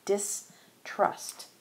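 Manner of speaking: student answering in a whisper; whispered student reply. Shown as a demonstration. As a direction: whisper the word back like a pupil. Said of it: "Our student replies by saying".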